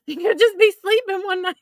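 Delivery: high-pitched, words squeezed out by laughter; laughing hard. Real, not acted. Woman joking about exploding blankets, laughing hard. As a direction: squeal it through a laughing fit. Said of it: "I'm laughing so hard".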